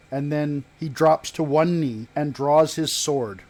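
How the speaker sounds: ordinary talk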